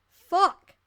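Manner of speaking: loud frustrated curse; frustrated yell. Real, not acted. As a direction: snap at her own mistake. Fumbles the reading.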